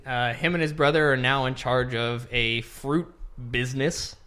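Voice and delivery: normal talking voice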